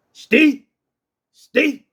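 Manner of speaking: high, sharp order to stand; authoritative command. Shown as a demonstration. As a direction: command the dog to stand still. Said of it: "you got to say it with authority".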